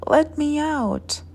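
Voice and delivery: high-pitched little voice, pleading to be freed; small, high acted shout; teeny tiny shout. A narrator's reading. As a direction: call out as a thumb-sized boy. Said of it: "shouted Tom's teeny tiny voice".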